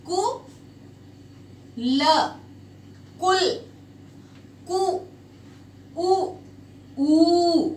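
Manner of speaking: कु ल कुल said in separate syllables, then उ short and ऊ long; sounding out कु and ऊ. Shown as a demonstration. Teacher sounding out कुल for the class, syllable by syllable. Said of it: "कैसे बोला जाता है?".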